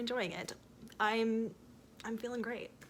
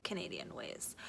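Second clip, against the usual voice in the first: hushed "Canadian ways"; whispered aside to viewers; 4 semitones lower, 11 dB quieter than usual. Real, not acted. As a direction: drop to a secret whisper.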